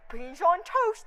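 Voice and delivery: high, loud joke British voice; hammed-up British accent, teasing; an American's loud parody of British speech; mock British accent. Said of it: "make a joke to a British person".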